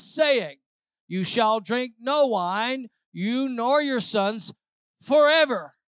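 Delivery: slow, loud, firm declaration of the vow; emphatic refusal. Acted. Preacher voicing the Rechabites refusing wine, slow and loud. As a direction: proclaim it like an unbreakable oath.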